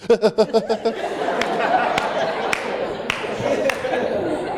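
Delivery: long run of stuttering sob gasps; mock hiccupping, shuddering breaths; hitching sobs. Shown as a demonstration. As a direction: imitate trying to stop crying. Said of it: "You start doing this".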